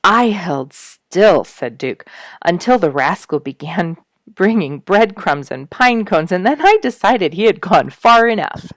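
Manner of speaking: Duke's voice. A narrator's reading. Narrator voicing Duke telling how he held still. Duke's quoted words, read aloud.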